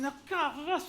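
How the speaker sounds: high, lilting North Welsh teacher. Retold or imitated. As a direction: put on the prim Welsh music teacher. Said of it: "they all speak like this".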